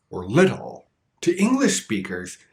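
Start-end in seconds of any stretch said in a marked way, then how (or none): 0.3-0.9 "little" with a lightly flapped T. Shown as a demonstration. Teacher giving learners an example, "little" with flap T. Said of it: "The flap T has very light contact".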